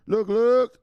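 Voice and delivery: loud, high "look, look"; pumped-up shout; hyped catchphrase. Real, not acted. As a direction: shout it out like a hype man.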